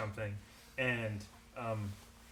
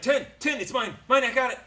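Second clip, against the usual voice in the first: eager shout; 11 semitones higher, 11 dB louder than usual. Acted. GM voices eager villager buying.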